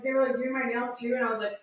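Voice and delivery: ordinary delivery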